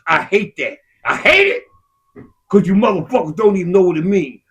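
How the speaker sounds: ordinary talk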